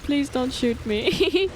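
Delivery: normal talking voice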